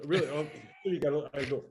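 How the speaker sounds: ordinary talk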